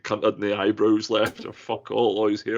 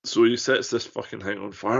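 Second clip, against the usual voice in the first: ordinary talk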